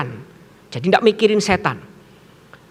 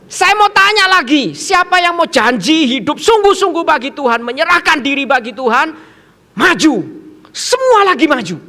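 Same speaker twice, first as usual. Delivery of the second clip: loud, high-pitched summons; forceful altar call; 7 semitones higher, 5 dB louder than usual. Retold or imitated. Preacher asking pupils to commit and come forward, forceful. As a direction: call out to the whole hall.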